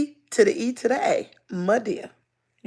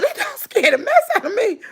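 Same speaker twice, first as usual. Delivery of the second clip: high-pitched, laughing retold scare; mock fright; 11 semitones higher than usual. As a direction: exclaim it high, half laughing at your own fright. Retells her scared exclamation, laughing.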